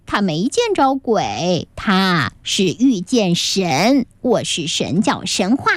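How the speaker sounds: slow boastful god voice. Acted. Boy bluffing, claims to be god. (男孩装神弄鬼，低沉缓慢地自夸)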